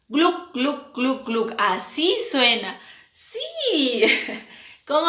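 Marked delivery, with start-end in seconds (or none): 0.1-1.5 gluc gluc de tragos imitados; tragando agua. Shown as a demonstration. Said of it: "imagínense cuando toman agua así". (sonido de tragar agua)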